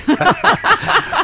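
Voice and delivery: loud, high laugh; high burst of laughter. Real, not acted. Guest laughs at her memory.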